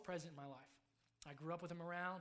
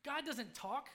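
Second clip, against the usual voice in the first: higher, much louder retold accusation; frustrated outburst; 8 semitones higher, 10 dB louder, slower than usual. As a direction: throw it at your friend in frustration. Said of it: "and I said".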